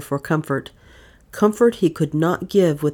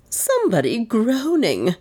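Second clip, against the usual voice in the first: high, horrified gasp; high-pitched shocked report; gasped horror; 7 semitones higher than usual. A narrator's reading. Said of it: "gasped Miss Cornelia".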